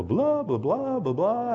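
high, sing-song blah blah; mock babbling prayer. Preacher mocking his own rambling prayers as sing-song babble. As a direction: rattle on like an endless prayer. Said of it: "sometimes I'm blah".

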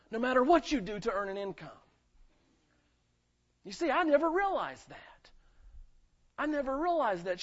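loud and high, climbing to a passionate peak; full-force preaching. Acted. Pastor crying out his testimony to the church, loud and high. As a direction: preach it out with fire.

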